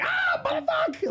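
high startled yell. Retold or imitated. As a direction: yelp at the cockroaches. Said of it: "I was just like".

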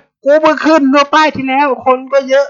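a foreigner protesting to the bus conductor, hammed-up and high; retold protest. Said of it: "ผมก็โวยวายเว้ย".

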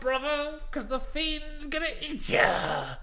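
high, loud wrestler promo; Hulk Hogan shout. Retold or imitated. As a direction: yell it like Hogan hyping.

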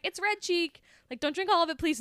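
playing Jezebel pleased with the dinner she made; sweet girlfriend voice. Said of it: "And she's like".

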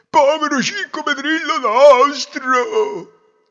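loud, slow, high-pitched lament; crying out. Acted. Father mourning the imagined grandson, wailing loudly. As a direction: wail it with stretched-out vowels. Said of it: "gridò il padre".

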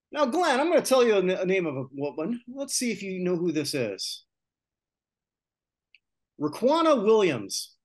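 loud, high, showy host; game show host voice. Pretend. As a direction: build suspense like a quiz show host. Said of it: "Game show host voice attempt here".

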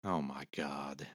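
far from the mic; off-mic, distant; fading out. Real, not acted. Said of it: "he's physically walking away from the microphone".

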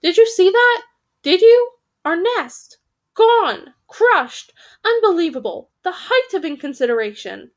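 indignant outburst, high and loud at first; angry raven. A narrator's reading. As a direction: squawk in outrage, pitch easing toward the end. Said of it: "a very angry raven".

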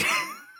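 high squeal of a laugh; embarrassed high-pitched giggle. Real, not acted. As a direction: burst into a shrieking giggle. Laughing at own remark.

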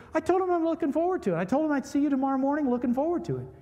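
high, fast, loud defensive protest; flustered protest. Retold or imitated. Preacher to wife about the note, rushed, high and loud. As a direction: rush it out, defending yourself. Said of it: "I said".

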